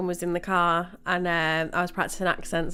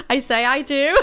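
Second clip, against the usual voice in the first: put-on higher, louder Welsh accent through giggles; laughing; 11 semitones higher, 4 dB louder than usual. Signing off in character, laughing in a mock Welsh accent. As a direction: crack up while playing the Welsh leprechaun.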